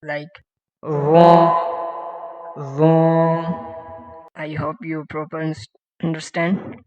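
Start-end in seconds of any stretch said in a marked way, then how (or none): 0.8-3.4 Von said heavy against the upper teeth. Shown as a demonstration. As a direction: Von held long and emphatic. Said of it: "with a heavy sound".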